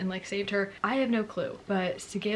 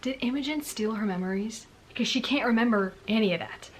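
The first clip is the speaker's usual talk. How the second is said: usual speaking voice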